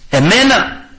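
affronted disbelief. Acted. Preacher playing a proud man who was struck, indignant. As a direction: play the proud man who can't believe it.

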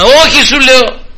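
high, loud insistent denial; a rattled man insisting he was not there; Peter's denial. Retold or imitated. Peter's denial, acted quarrel. (έντονη, ψηλή άρνηση)

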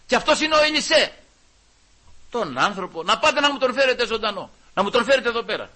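loud, high royal order; commanding king. Acted. Syrian king ordering soldiers: loud command. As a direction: bark the order to bring him.

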